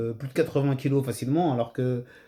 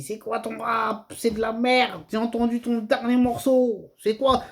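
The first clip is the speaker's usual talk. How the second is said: higher, slow barking insults; 7 semitones higher, slower than usual. Retold or imitated. Little brother barks insults at famous rapper [name], retold mock anger. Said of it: "à aboyer sur [name]".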